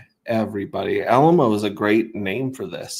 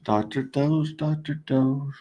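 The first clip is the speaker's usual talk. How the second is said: higher, sing-song "Doctor Doge"; a playful higher sing-song call; 6 semitones higher, slower than usual. Pretend. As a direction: call it like paging a pet. Sing-song calling the token.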